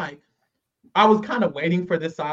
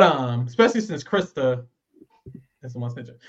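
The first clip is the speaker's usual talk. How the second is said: normal talking voice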